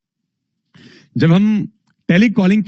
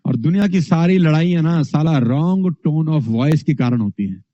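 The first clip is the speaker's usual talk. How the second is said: ordinary talk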